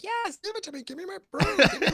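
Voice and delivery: high pleading witch. Retold or imitated. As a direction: whine it like the witch wanting her broom. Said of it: "she's like".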